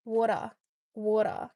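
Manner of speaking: "Water" with the r dropped, Aussie style; Australian accent. Shown as a demonstration. Working out how Australia says water, Australian accent. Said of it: "in Australia, how do you even say it?".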